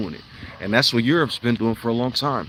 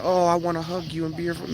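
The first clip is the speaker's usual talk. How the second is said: higher, mocking sugary-nice voice; mock sweet; 7 semitones higher than usual. Speaker mocks a gentle huggy Jesus in a sugary voice. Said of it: "He wasn't just going around saying".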